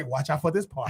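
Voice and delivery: low voice, know-it-all friend; a lightly voiced know-it-all; imitating B J. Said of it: "He gonna say".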